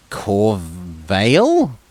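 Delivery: usual speaking voice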